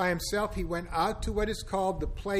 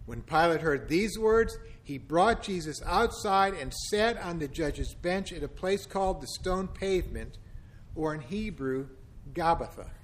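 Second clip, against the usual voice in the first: ordinary delivery, nothing marked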